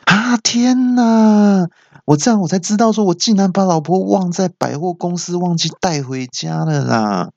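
husband realizing he forgot his wife; shocked gasp. Retold or imitated. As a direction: cry out in horrified realization.

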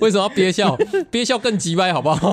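high, laughing while teasing. Real, not acted. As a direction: say it through laughter. Teasing friend during laughter.

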